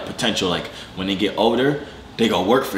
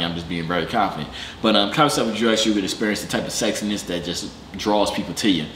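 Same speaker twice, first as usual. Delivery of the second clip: sponsor ad read; 3 semitones lower than usual. Acted. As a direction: slide the plug in casually. Reads a sponsor ad.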